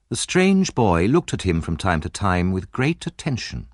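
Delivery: plain reading voice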